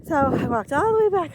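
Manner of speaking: puffing after the uphill climb, real breathlessness; out of breath. Real, not acted. To viewers after hiking back uphill in the cold, out of breath. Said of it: "I can't get enough oxygen".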